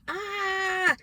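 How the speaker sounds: high, drawn-out mock scream; retold scream. Venting in an angry letter to mom, mock scream. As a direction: let out a long, high-pitched "aah". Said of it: "I even went".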